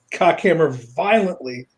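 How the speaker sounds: normal talking voice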